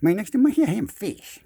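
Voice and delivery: high, loud fish voice; goofy fish impression; underwater fish voice. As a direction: play the fish right by his ear. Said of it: "I was trying to do fish underwater".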